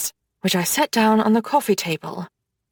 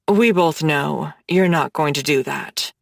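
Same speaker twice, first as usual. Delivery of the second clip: Hades, low voice; 7 semitones lower than usual. Acted. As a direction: tease her, low and confident like a dark god.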